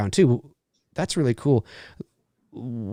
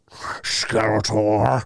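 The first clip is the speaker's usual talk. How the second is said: drawn-out, rough creature growl; cartoon creature voice; 2 semitones lower, much slower than usual. Fan imitates a He-Man cartoon character, drawn-out creature voice. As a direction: stretch the name out in a monstrous voice.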